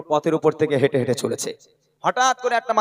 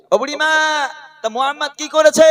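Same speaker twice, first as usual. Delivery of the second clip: high raised voice, endings stretched upward; questioning stranger calling; 10 semitones higher than usual. Retold or imitated. Man asks old woman about her trouble, high-pitched calling question. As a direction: call out the question at a much higher pitch.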